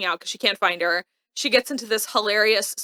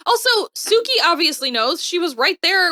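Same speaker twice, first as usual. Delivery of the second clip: exasperated argument; 7 semitones higher, 3 dB louder than usual. Real, not acted. Ranting about obvious plot secret.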